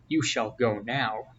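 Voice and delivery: ordinary delivery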